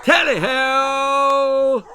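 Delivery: high, loud, very slow laughing tally-ho; hunting cry. Acted. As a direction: whoop it out gleefully. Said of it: "Lord Percy cried".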